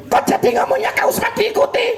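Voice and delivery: high, fast puppet voice parroting with a laugh; puppet copying. Acted. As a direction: mimic him back as the cheeky puppet. (suara boneka tinggi dan cepat meniru)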